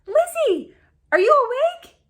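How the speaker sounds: high, loud call through the door. A narrator's reading. Sisters calling through the door, loud and high. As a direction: call out to wake her. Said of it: "Loud voices rang out".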